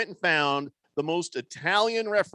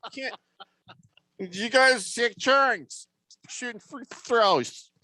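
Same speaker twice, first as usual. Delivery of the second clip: confused referee; 4 semitones higher than usual. Retold or imitated. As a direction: act out a ref lost in the free throws.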